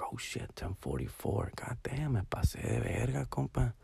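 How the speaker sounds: near-whispered cursing to himself; acted groggy whisper of alarm; hushed dismay. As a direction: mutter it very softly, just woken. Retells seeing the clock late.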